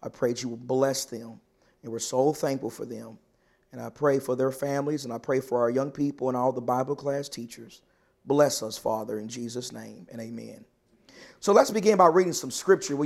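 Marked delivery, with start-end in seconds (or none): 0.0-10.6 soft, low prayer voice fading at the amen; quiet prayer. Acted. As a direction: pray gently over the congregation, trailing off to the end. Pastor leads a prayer.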